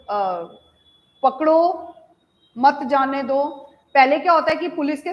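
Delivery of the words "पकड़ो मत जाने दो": पकड़ो stands alone, raised, then मत जाने दो after a clear break; pause after पकड़ो. Shown as a demonstration. Teacher opening the police and thief story, pause after पकड़ो. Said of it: "उस तरह से बोला".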